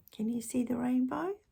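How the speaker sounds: usual speaking voice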